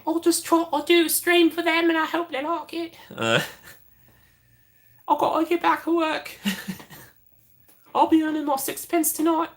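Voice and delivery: very high, squeaky mock Cockney; bad Cockney accent. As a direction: ham up a terrible Cockney in a high voice. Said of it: "a really bad Cockney accent".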